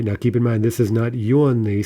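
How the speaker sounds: ordinary delivery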